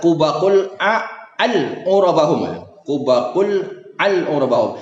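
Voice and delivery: slow halting misreading of the corrupted Arabic; reading the garbled text. Shown as a demonstration. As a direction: stumble through the broken transliteration. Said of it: "Tapi di sini kita lihat".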